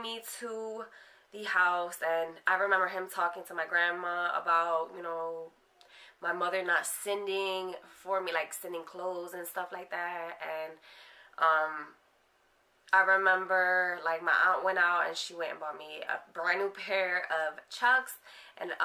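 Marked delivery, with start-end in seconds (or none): none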